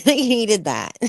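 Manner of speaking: slow, amused reply broken up by laughter; laughing. Real, not acted. Teasing a dad who admits helping his son, laughing.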